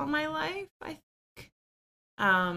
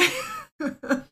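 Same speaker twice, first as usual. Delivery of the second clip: short laugh; 11 semitones higher than usual. Real, not acted. Laugh after retelling.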